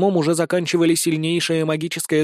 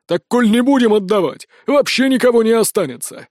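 louder, higher protest; heated objection; 5 semitones higher, 4 dB louder than usual. A narrator's reading. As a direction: argue back heatedly. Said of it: "возразил староста". (горячо возражая)

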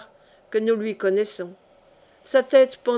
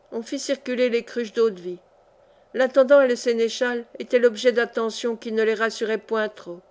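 plain reading voice

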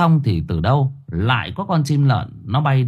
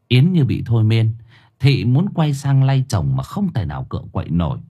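ordinary delivery, nothing marked

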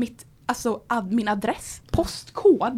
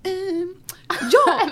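higher, halting nervous call; nervous hesitant; 5 semitones higher, slower than usual. Retold or imitated. Calling her parents after the scary message, nervous and hesitant. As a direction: stammer it out like a scared kid. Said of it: "Jag ringer mina föräldrar och bara".

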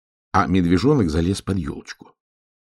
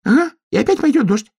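very high, fast squeaky chatter; excited squeaky storybook animal; small animal voice; 4 dB louder, faster than usual. A narrator's reading. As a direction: pipe it up quickly in a tiny voice. Animal answers the trapped cub.

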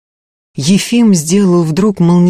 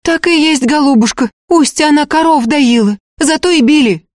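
high, eager-to-placate wail; high-pitched fearful corroboration; frightened chorus; 10 semitones higher than usual. A narrator's reading. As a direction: confirm it anxiously like a scared peasant woman. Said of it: "они слились в единый хор".

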